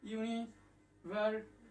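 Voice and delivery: "University" drawn out, very slow; slow spelling-out. Shown as a demonstration. Teacher writing the word slowly for the class. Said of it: "university লিখলাম".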